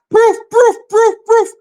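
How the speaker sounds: high, loud explosive puffs; repeated punchy high puffs; puffing sound effect. Retold or imitated. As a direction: pop out four sharp bursts. Imitates rapid bursts, "just like.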